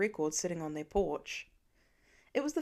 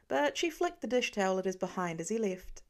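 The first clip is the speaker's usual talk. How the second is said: plain reading voice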